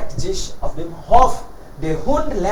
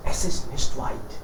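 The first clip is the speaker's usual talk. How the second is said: whispered aside; 8 semitones lower, 6 dB quieter than usual. A narrator's reading. Storyteller drops to a whisper.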